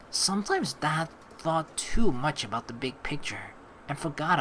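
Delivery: calm, measured reading of a story; story narration. Acted. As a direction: read it like an inner monologue. Reads the game's story text.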